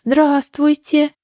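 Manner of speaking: high, drawn-out little greeting; timid squeak. A narrator's reading. Scared rabbit greets a huge dragon, squeaking. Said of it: "пропищал он".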